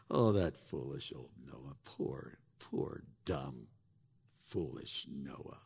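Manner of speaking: low, soft, slow mock pity; condescending scoffer, low and quiet; scornful pity. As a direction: sneer down at poor Noah. Scoffer pities Noah.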